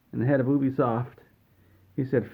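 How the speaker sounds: ordinary talk, nothing marked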